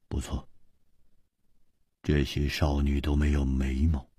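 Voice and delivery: plain reading voice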